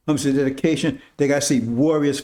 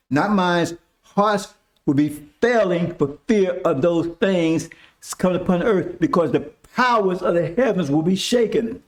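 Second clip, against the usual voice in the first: rising preaching; 4 semitones higher than usual. Acted. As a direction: preach it to the congregation, building. Preaching on hearts failing.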